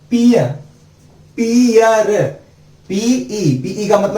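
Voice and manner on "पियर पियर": high clear demo. Shown as a demonstration. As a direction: pitch "peer" up for the class. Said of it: "E A R". (ऊँची आवाज़ में "पियर")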